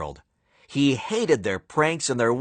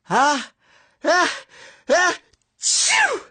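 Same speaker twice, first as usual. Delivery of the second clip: stuttered build-up to a very high sneeze; 3 dB louder than usual. Acted. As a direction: hold the uhs then explode the achoo. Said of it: "repeated the fans".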